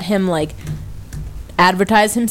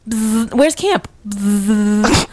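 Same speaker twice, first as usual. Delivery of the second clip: buzzing kid impression; 2 semitones higher, much slower than usual. Imitating strange kid asking directions, buzzing between words. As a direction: question wrapped in long buzzes. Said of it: "flapping his arms like wings, going".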